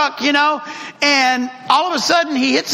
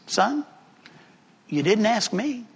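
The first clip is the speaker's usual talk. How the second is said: a softer, tender dad's reply; gentle dad; 3 semitones lower, 6 dB quieter than usual. Retold or imitated. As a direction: tell your son gently. Dad gently answers son.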